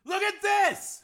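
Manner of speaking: high, loud yell over engines. A narrator's reading. Higgins yelling to Hardesty over the engine roar. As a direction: shout to be heard over the roar. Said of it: "he yelled".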